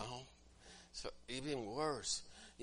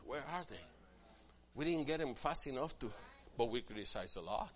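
usual speaking voice

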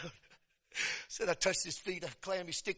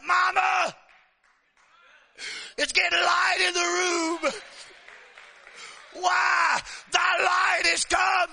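crying out; 7 semitones higher, much slower than usual. Retold or imitated. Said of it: "he cried out".

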